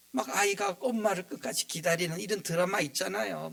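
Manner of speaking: ordinary talk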